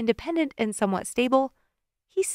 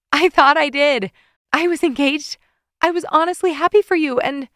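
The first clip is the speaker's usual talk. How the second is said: emotional confession; 6 semitones higher, 4 dB louder than usual. Acted. Tearful confession.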